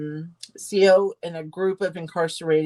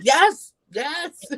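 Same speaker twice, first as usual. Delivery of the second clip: jumps much higher, a burst of joy; excited to myself; 11 semitones higher than usual. Retold or imitated.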